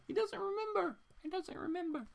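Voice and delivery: very high, soft squeak; squeaky quip. Real, not acted. Host teasing a viewer in a tiny high voice.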